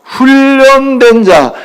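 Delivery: 훈련된 자 drawn out very slowly; 훈련된 자 spelled out word by word; slow emphasis. Shown as a demonstration. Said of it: "집에서 길리우고 뭐 된 자".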